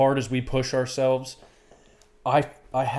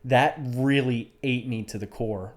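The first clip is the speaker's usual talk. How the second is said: ordinary talk, nothing marked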